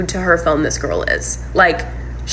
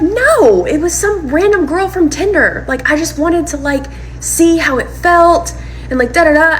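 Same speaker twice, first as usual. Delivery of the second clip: high, put-on partner voice; defensive denial; 9 semitones higher than usual. Partner denying it was Linda, high mock-defensive denial. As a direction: imitate an excuse-maker in a high voice.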